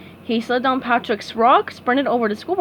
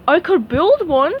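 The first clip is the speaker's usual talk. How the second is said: Patrick voice; 6 semitones higher, 6 dB louder than usual. A narrator's reading. Patrick to SpongeBob.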